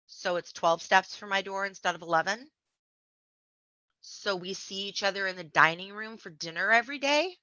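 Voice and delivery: normal talking voice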